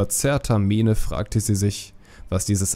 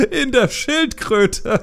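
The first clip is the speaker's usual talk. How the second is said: much higher, laughing through the punchline; roaring laughter; 12 semitones higher, 4 dB louder than usual. A narrator's reading. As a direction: burst out laughing at your own joke. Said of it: "Er brach in schallendes Gelächter aus".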